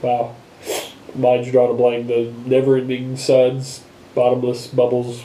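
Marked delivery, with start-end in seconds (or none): none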